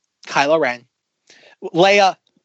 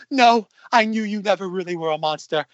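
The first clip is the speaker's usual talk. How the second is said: mock British accent, lightly tearful; a hint of Rey's British accent, half weeping; imitating a weepy British heroine; 3 semitones higher than usual. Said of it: "weeping with her British accent going".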